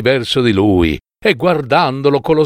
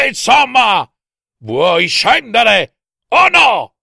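high, exasperated drawn-out yell; bawling; 11 semitones higher, 3 dB louder, slower than usual. A narrator's reading. Husband demands his wife come down, bawling. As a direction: bellow impatiently at a higher pitch. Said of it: "sbraitava Barba Blu".

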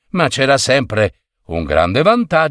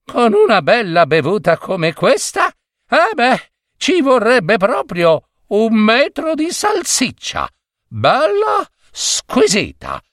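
high, savouring wish for sausage; longing exclamation; 10 semitones higher than usual. A narrator's reading. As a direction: exclaim it with relish after a good drink. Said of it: "esclamò sospirando". (esclamazione golosa e sognante)

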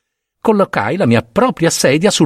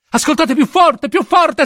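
frantic shouting; 12 semitones higher than usual. A narrator's reading. Killer screaming at the police officers, frantic high yell. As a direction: yell it at them, losing control.

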